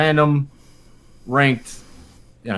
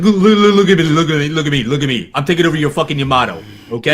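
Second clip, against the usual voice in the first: loud, higher mock intimidation; mock tough threat; 3 semitones higher, 5 dB louder than usual. Gamer acting out seizing a troll's ship, loud mock threat. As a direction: grab him by the throat and bark orders. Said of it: "just be like".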